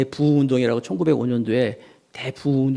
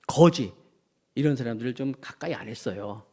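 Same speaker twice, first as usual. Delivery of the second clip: ordinary talk, nothing marked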